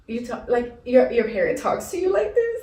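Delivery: rising high, shocked questioning; retelling her shock at other families; incredulous. Retold or imitated. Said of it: "And I'm just like".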